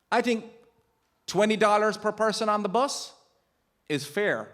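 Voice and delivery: lightly voiced tour guide sounding reasonable; mimicking the tour guide. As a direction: play the guide casually naming his fee. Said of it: "he suggested a fair amount to me".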